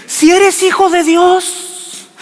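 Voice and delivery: high, slow, sneering devil voice; mock the son with a teasing high drawl; devil taunting. The devil taunting hungry Jesus, high and sneering. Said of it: "Y le dice".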